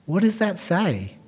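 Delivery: ordinary delivery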